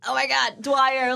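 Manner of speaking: acted-out embarrassed outburst; retold exclamation. Retold exclamation to amused professor.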